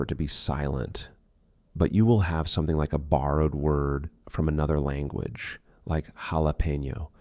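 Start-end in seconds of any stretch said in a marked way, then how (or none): 6.1-7.1 JALAPENO starting with H, "halapenyo"; H sound. Shown as a demonstration. Teacher showing a borrowed word, JALAPENO with H sound. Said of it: "with an H sound".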